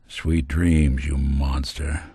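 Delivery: low, slow parting shot; grim send-off. Acted. Companion sending off a monster, grim low send-off. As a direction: deliver it low and heavy, slowing at the end.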